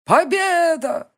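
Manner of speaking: high, slow, drawn-out shout. Acted. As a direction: yell it till your throat hurts. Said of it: "Ещё и ору так".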